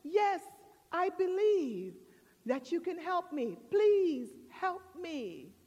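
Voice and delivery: high, earnest mock plea; man pleading. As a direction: answer eagerly, then stretch out the begging. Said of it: "He said".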